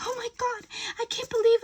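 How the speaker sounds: shocked gasp. Retold or imitated. Hurt victim reacting to gossip, high shocked voice. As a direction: sound rattled and caught off guard.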